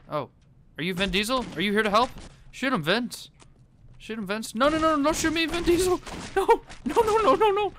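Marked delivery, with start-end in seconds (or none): 4.6-7.7 high, loud frantic pleading; panicked begging. Real, not acted. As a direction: plead for your life in real panic. Begs Vin Diesel not to shoot.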